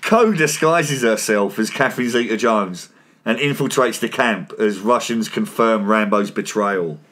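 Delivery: steady scripted voice-over read; podcast recap read; recap narration. Acted.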